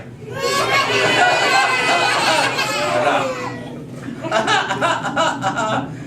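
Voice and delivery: very high pretend bawling; loud hammed-up child's wail and sobs; mock crying. Teacher leads children crying as the little girl, mock bawling. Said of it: "let me hear you cry".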